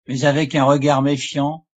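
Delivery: usual speaking voice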